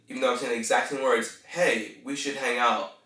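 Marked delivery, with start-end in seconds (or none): none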